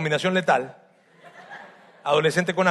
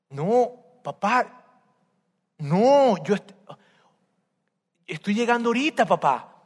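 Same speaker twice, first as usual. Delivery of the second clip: stunned pleading; 4 semitones higher than usual. Acted. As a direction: beg in disbelief like a wronged son.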